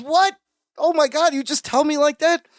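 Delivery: high-pitched mock disbelief; shocked. Imitating a brother told his dog died, shocked. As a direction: blurt it high, appalled.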